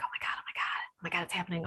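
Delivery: barely voiced, amazed; awed whisper. During meditation, whispering to herself in awe. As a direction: whisper it in wonder.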